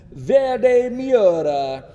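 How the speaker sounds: loud, high, drawn-out mock Italian accent; hammed-up Italian accent. Reviewer naming the ultimate Miura colour, bad Italian accent. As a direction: sing out the name in an exaggerated Italian accent. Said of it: "God, I did the Italian accent".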